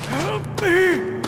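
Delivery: panic, shrieking to be saved; a frantic plea, voice cracking high; desperate cry. Acted.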